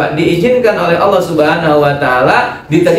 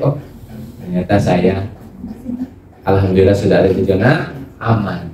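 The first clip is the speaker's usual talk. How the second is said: relieved and calm; 8 semitones lower, 5 dB quieter than usual. Retold or imitated. Voicing a relieved believer.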